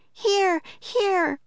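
put-on very high girl's call; Annie crying out. A narrator's reading. Annie calling Jack in the dark, high cry. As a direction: call the name high and urgent. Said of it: "cried Annie".

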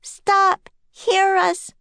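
put-on high, urgent girl's voice; young girl Annie. A narrator's reading. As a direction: call out high to stop them. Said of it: "said Annie".

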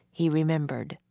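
plain reading voice

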